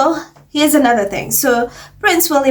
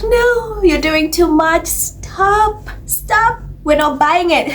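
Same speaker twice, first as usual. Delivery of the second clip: mock exasperated; 7 semitones higher, slower than usual. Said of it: "it always backfires".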